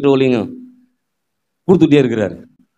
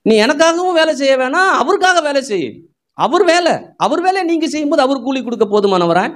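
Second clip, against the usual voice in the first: higher, rising sermon cry; preaching at full force; 7 semitones higher than usual. Acted. Pastor rousing his congregation, preaching at full force.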